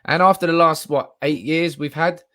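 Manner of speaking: loud insistence. Real, not acted. Defending the fans' celebration.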